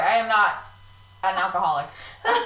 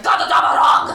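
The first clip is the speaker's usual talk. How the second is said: harsh barked nonsense, loud and unvoiced; imitating a German yelling at a cat; mock German shout; 9 dB louder than usual. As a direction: yell it like an angry German teacher. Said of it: "and it's like".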